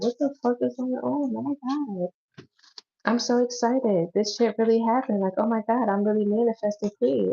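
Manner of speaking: high, giddy squeals of disbelief; excited. Pretend. A client hearing her wish came true, squealing with excitement. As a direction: gush like your wish just came true. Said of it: "You're gonna be so excited. Like".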